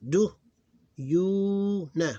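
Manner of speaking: "Do, you, nah" loud, with "you" held long; clear syllables. Shown as a demonstration. Teacher making it clear for students, syllable by syllable. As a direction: separate each beat and stretch "you". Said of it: "to make it clear".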